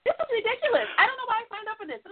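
high, fast, frantic inner protest; panicky brain voice. Retold or imitated. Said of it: "But your brain is like".

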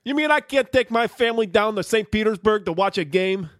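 high, fast whiny outrage from a mocked fan; outraged fan impression. Podcast host mocking an angry rival fan, whiny high outrage. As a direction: squeal it like an indignant fan denied a ticket. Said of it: "with this impression right now".

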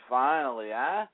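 ordinary talk, nothing marked

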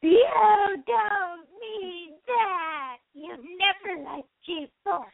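slow, tearful drama queen wail; drama queen Rarity voice. Acted. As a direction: sob it out like a theatrical diva. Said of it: "My best drama queen Rarity voice".

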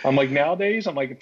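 ordinary talk

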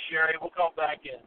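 ordinary talk

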